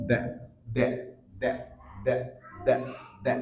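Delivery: THERE cut to a very short "deh"; weak form. Shown as a demonstration. Teacher drilling weak "there", weak form. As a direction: say "দ্যা" briefly, no r. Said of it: "উইক sound হয়".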